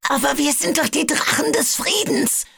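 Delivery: high, strained, forced out between clenched teeth; through gritted teeth. A narrator's reading. Webbs pleads with his attackers through gritted teeth. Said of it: "stieß Webbs zwischen zusammengebissenen Zähnen hervor".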